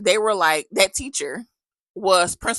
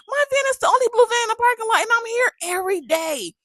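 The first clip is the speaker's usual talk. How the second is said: very high, fast disbelieving outburst; can't believe what she's hearing, high and fast; incredulous outburst, pitched way up and rushed; 3 dB louder than usual. Retold or imitated. Incredulous retort about her van.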